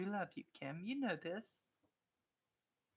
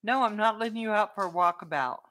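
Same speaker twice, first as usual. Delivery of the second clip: loud, higher-pitched refusal to a pet; raised voice, talking to the dog; telling off dog; 5 semitones higher, 9 dB louder, faster than usual. Real, not acted. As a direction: tell the dog no, loudly.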